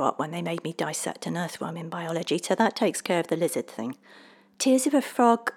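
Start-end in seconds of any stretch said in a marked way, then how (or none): 4.6-5.5 Tears of a frog said higher and louder in disbelief; incredulous echo. Acted. Kyle repeating the leprechaun's odd ingredient, incredulous echo.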